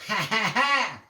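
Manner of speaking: high, loud teasing ha ha ha; mocking laugh. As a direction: laugh down at the hard worker. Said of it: "laughed the first little pig".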